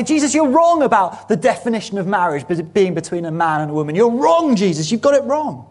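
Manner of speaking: a hammed-up indignant believer; defiant objector. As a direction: play the stubborn churchgoer arguing back. Preacher voicing a defiant objector.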